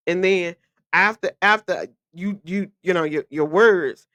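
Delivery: normal talking voice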